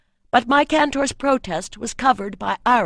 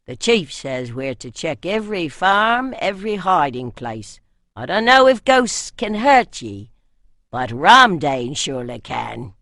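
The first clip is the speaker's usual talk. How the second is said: put-on northern accent; 3 semitones lower, 6 dB louder than usual. A narrator's reading. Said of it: "This in the accent of the north".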